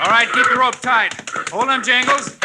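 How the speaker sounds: high ringing heroic calls; brash old-fashioned hero bravado. Acted. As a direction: bold cowboy hero shouting orders. Hero calling out during roundup.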